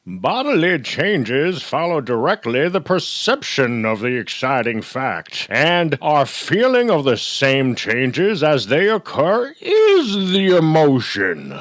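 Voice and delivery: put-on loud, high, slow old-timey orator. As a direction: declaim it like an old-timey quotation, stretching the final words. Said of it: "I had to do my old-timey voice".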